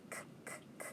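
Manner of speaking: whispered K sounds, unvoiced. Shown as a demonstration. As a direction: puff out each K sound. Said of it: "teacher sounds out cake".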